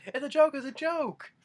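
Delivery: high, loud imitated protest; mock pleading yell. Everyone telling Grandpa it's a prank, high urgent yell. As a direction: cry it out urgently as others would. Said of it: "everyone was like".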